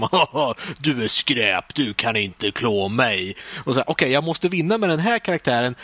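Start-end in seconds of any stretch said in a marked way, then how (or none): 0.0-3.4 lower, slow boss sneer; a hint of a gloating villain; lightly voiced boss taunt; taunting final boss. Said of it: "sista bossen".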